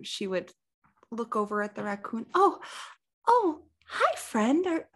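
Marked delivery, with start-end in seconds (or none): 2.3-4.9 high sweet voice. Acted. Maggie greets a raccoon.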